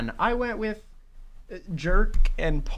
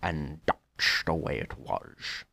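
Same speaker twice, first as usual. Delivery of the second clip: Walter Cronkite impression; 8 semitones lower than usual. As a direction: lower newscaster sign-off voice. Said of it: "Channel your inner Walter Cronkite".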